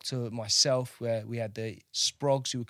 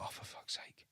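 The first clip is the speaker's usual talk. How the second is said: 1 whispered exasperation; 18 dB quieter than usual. Retold or imitated. Annoyed thought during the slide show.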